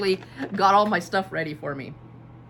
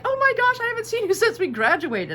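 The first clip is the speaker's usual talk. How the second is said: much higher, louder amazed gush; excited gush; 5 dB louder than usual. Retold or imitated. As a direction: squeal with reunion joy. Classmate's excited reaction.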